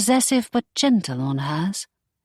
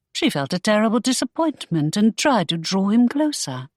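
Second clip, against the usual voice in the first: plain reading voice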